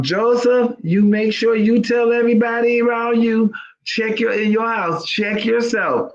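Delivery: high, pressing call to her nephew; aunt urging her nephew to check himself, high and insistent; urgent call to Joseph. Retold or imitated. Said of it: "I'm gonna say".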